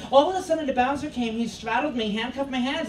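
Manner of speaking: ordinary delivery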